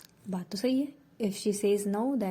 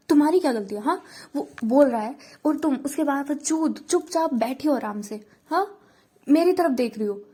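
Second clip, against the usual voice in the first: loud, higher, heated scolding; jealous rant; 3 semitones higher, 5 dB louder than usual. Acted. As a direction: rant at her in jealous fury. Sharvari jealous rant at Ditya.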